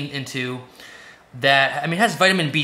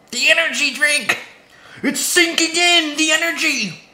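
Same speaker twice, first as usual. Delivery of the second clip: hyped up; 10 semitones higher, 4 dB louder than usual. Pretend. Caffeine hitting, hyped.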